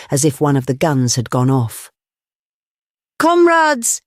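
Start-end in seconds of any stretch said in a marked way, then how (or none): 3.2-4.0 much higher, louder rallying cry; booming call to the hall; ringing cry. A narrator's reading. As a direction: make every man jump. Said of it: "he cried".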